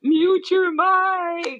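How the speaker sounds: high, long-drawn singsong call; mock calling out. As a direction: stretch it out as if hollering across the room. Mock calling out to microphone culprit.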